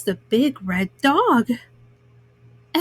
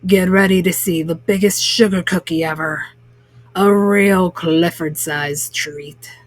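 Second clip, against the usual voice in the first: lower, boastful showman voice for the baker; a proud baker hyping his giant cookie; Mr Bleakman's voice; 7 semitones lower than usual. A narrator's reading. Baker to the crowd, proud lower showman voice.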